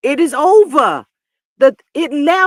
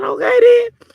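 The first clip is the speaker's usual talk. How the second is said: high mock okay; 11 semitones higher, 6 dB louder, slower than usual. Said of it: "I said".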